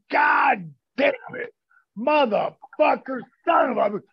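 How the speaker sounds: hammed-up shouted cursing; mock cursing yell. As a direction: bellow the curses in mock outrage. Retold cursing yell on phone.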